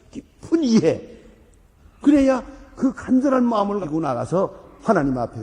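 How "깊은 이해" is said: 깊은 이해 drawn out slowly, each syllable weighed; slow emphasis. Real, not acted. Preacher stressing his key phrase, slow emphasis. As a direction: lean hard on 깊은 이해, slowly. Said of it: "깊은 이해가 있어야 돼요".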